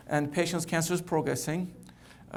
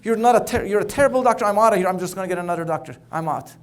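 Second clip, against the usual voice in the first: upset outburst; 3 semitones higher, 8 dB louder, faster than usual. Acted. Furious patient storms out yelling at his doctor. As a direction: blow up and shout him down. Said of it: "He gets all upset".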